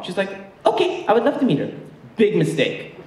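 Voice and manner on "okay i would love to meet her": put-on high, accented mother asking; Egyptian mom voice. Mom asking son about girlfriend, high accented voice.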